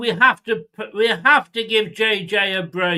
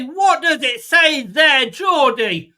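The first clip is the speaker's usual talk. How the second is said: higher, louder and slower, jeering at the ref; exasperated mocking yell; 6 semitones higher, 6 dB louder, slower than usual. Real, not acted. Streamer jeering at referee Geordie, exasperated loud yell. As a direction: bellow the question at Geordie like he can't read.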